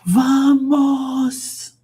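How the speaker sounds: high drawn-out "Vamos!" rallying call; mock footballer urging teammates on; imitating a footballer's cry. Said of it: "they're like".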